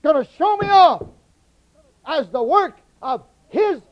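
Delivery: building to a shouted climax, "show me off" at the top of the voice; preaching at full force. Acted. Preacher to congregation, rising to a full shout.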